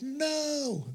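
high, soft gasping "No"; shocked no. Retold or imitated. Preacher voices churches hearing the rebuke, high shocked no.

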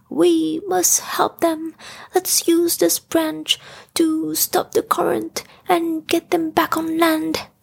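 high, steady character voice making a declaration; Pooh. A narrator's reading. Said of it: "he declared".

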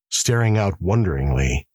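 usual speaking voice

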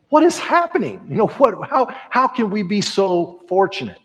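high, astonished disbelief; amazed wonder. Retold or imitated. Preacher voicing John's astonished wonder. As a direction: marvel at it in awe. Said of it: "you can just hear John saying".

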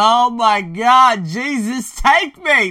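high, drawn-out hammed-up wail; mock baby crying. Joking about crying when she left, mock bawling. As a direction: wail it as a joke, loud and high. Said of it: "I cried like a baby".

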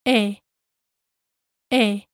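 E held as a single pure vowel, no glide into I; Spanish pure E. Shown as a demonstration. Teacher modelling the Spanish E sound. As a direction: say E short and steady, Spanish style. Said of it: "the two E's in ofrecer sound like this".

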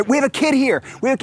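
high, urgent call for help; calling out in panic. Retold or imitated. Parents in a haunted house calling for help, high and urgent. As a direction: call out to strangers in the dark. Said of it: "and we're".

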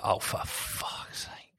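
slow, whispered exasperation; exasperated. Retold or imitated. Man reacting to a friend's mishap, slow exasperated whisper. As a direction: whisper it slowly, fed up. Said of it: "I'd be like".

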